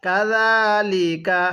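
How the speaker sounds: কাযালিকা with a soft য, not a জ; নরম করে কাযালিকা; soft z sound. Shown as a demonstration. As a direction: say কাযালিকা slowly with a gentle য. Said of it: "নরম করে।".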